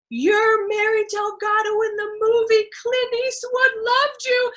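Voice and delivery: high, drawn-out singsong announcement; agent's big news. Retold or imitated. Agent telling actress she got the movie role, elated singsong. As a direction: sing out the good news, stretched and high.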